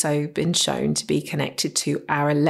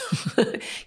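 breathy laugh; 5 semitones higher, 4 dB quieter than usual. Real, not acted. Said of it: "laughs at own posh voice".

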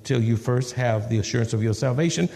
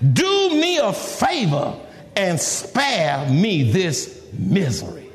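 high, slow, fed-up plea for release; despairing demand, falling away at the end; frustrated Moses; 10 semitones higher, slower than usual. Acted. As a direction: beg dramatically, drawing out each phrase.

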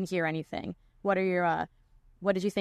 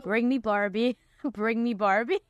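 mock-eager challenger calling out an opponent; half-laughing, drawn-out mock war cry; hammed-up battle cry; 3 semitones higher, slower than usual. Teasing co-host about being ready to attack Barbie, hammed-up challenge.

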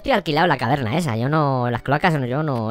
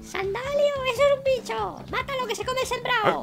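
shrill villager woman; 6 dB quieter than usual. Acted. Villager woman shouting at Sandalio.

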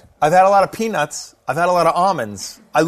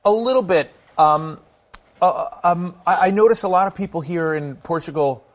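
ordinary delivery

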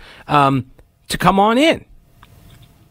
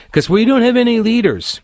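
mock protester; 8 semitones higher than usual. Mocking the protesters' claim.

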